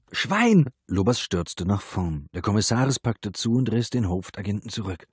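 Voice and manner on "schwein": high, loud cry; shrieked curse, pitched way up; screamed insult. A narrator's reading. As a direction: scream it after being hit. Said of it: "dann ein Schrei".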